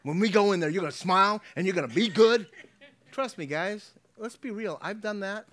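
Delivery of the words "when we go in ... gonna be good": loud, raised parental warning; acted stern warning, high and forceful; scolding parent. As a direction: lay down the law to a child. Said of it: "ever used the phrase".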